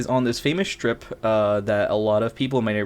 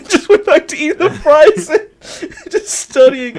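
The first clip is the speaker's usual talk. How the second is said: laughing; 8 dB louder than usual. Real, not acted. Laughing while telling it.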